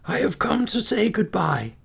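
high, strained goodbye; doomed father, voice pitched high; sorrowful farewell. A narrator's reading. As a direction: say a last goodbye to your children. Doomed father bids children farewell.

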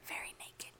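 very soft whisper; whispered aside. Real, not acted. Listener adds a racy aside to the storyteller, whispered. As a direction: slip it in under the breath, whispered.

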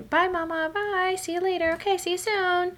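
put-on high, sweet toddler saying goodbye; mother imitating her young daughter waving bye; little girl voice. Mom voicing her little daughter, high and sweet. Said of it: "you know, Remy".

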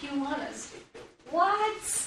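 disbelieving what, pitch shooting up; incredulous Peter. Retold or imitated. Preacher voicing Peter questioning Jesus, incredulous rise. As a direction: balk at the order in mock disbelief.

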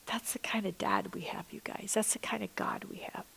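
soft, hushed, reverent whisper to the congregation; awed whisper. Real, not acted. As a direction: lean in and whisper the wonder of it. Intimate awe about God.

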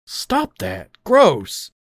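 high-pitched, grossed-out outcry; disgusted cry.

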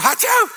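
high, loud mock sneeze. Pastor acting a coworker sneezing, loud mock sneeze. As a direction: fake a big sneeze. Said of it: "Someone at work".

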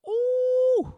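loud, high drawn-out ooh; long high "ooh". Pretend. Podcaster on lighting a scented candle, playful high ooh. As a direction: coo a big playful "oooh".

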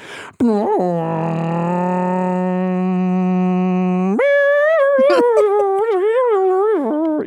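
long, steady nasal drone like bagpipes; bagpipe imitation. Remembering a neighbour's daily bagpipes, a steady droning hum. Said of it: "but it'd be like".

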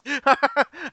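high-pitched real laugh. Real, not acted. Cohost excited about the crowd knowing the wrestler, high laugh.

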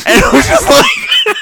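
laughing high and loud in disbelief; cracking up mid-sentence; incredulous laugh. Real, not acted. Mocking laugh at the defense.

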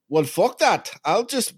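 put-on hammy South African accent; mock South African accent. A podcaster mimicking a rich heir, mock South African accent. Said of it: "I don't know what accent I'm doing".

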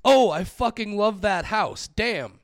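loud, high exasperated rant; reading notes with outrage. Shown as a demonstration. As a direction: read the notes like live reactions. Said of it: "goes something like this".

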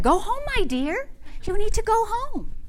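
high, lilting coaxing voice; gently pleading little lady voice. Retold or imitated. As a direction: coax the dog home, pitched high. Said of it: "and she said".

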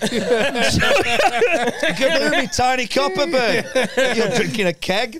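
squeaky giggling silly voice; high laughing voice. Pretend.

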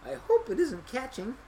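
put-on high, girlish aside; little girl's thought. A narrator's reading. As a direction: worry to yourself as a child.

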